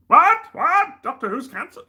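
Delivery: very high, loud squawks of disbelief; shocked outcry. Acted. Doctor learns that Doctor Who is canceled, hammed-up shocked shriek. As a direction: yelp in hammed-up shock, pitch shooting right up.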